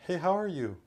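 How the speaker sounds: high rising upspeak greeting; chirpy upspeak, voice jumping way up; the wrong way, squeaky rising greeting. Shown as a demonstration. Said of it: "No upspeak".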